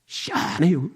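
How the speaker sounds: understated Chungcheong reply; Chungcheong dialect. Shown as a demonstration. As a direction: draw out the first syllable, Chungcheong style. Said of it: "우리 충청도는".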